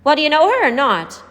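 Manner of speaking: high, loud impatient demand. A narrator's reading. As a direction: press him for an answer.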